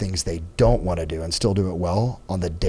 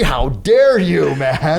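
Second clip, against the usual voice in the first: loud, higher-pitched indignant outburst; mock outrage; 6 semitones higher, 7 dB louder, much slower than usual. Embarrassed before a mentor, retold loud outrage. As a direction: draw out the protest in loud, acted indignation.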